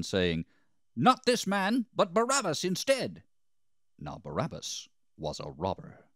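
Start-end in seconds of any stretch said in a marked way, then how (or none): 0.9-3.2 high, loud crowd shout; crying out. A narrator's reading. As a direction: shout your demand as an angry mob. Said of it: "they all cried out again".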